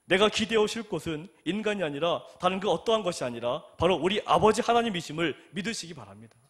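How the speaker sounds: loud, earnest exhortation; fervent preaching. Acted. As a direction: press the point home from the pulpit.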